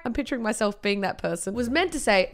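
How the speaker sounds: ordinary delivery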